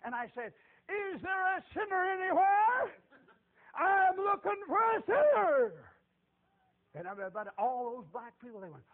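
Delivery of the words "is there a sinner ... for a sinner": high, loud, slow crying call; loud, high, tearful-edged street preacher call; preaching yell. Retold or imitated. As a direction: call out across the field. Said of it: "started yelling and screaming and preaching".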